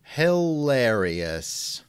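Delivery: ordinary talk, nothing marked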